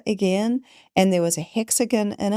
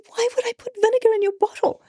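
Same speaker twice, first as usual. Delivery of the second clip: high, fast, indignant disbelief; incredulous denial; faster than usual. Retold or imitated. Friend protesting she never touched the bottle, high and fast. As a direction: fire it back high and quick, offended. Friend denies spiking the water.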